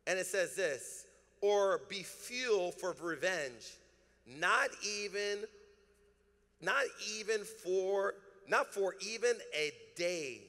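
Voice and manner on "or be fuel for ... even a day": slow emphatic reading. Acted. Pastor reads the verse with weight.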